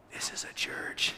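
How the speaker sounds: whispered in quiet wonder; awed hush. Retold or imitated. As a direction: breathe it out in awe. Apostles awed by communion.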